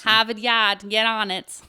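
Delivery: put-on loud, half-hearted Boston accent; shaky stab at Boston; attempted Boston accent. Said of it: "I can't do a Boston accent".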